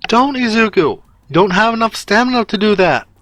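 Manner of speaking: high, urgent cry of protest; alarmed plea. Acted. Ochako realizing what Izuku is attempting, alarmed high-pitched plea. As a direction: beg them to stop, voice pitched far up.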